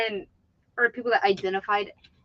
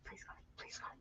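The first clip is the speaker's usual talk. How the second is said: whispered plea, barely voiced; mock whisper; 29 dB quieter, faster than usual. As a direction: whisper it like begging for the call. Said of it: "She's like".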